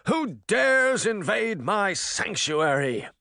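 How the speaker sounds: high, slow booming spirit; roaring mirror voice. Acted. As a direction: thunder it from the glass.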